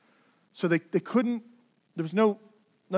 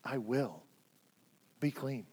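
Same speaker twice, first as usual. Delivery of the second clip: softer, lower and gentle; tender hush; 5 semitones lower, 9 dB quieter than usual. Shown as a demonstration. Jesus to the outcast leper, quiet and tender. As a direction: say it softly, like a tender promise. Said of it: "Jesus touches him and says".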